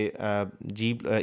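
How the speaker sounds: ordinary talk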